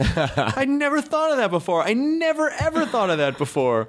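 high-pitched, delighted amazement; amazed. Real, not acted. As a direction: blown away by a new idea. Amazed by the accent theory.

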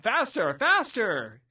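high, loud urgent command; demanding shout. Retold or imitated. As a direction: bark the order at him. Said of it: "Vizzini demands".

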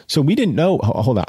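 ordinary delivery, nothing marked

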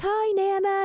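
put-on high child greeting; little kid voice. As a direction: chirp it like a kid. Child grandson voice.

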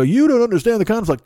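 hammed-up objection in a high voice; mock scolding. Mocking imagined letter writers' complaint.

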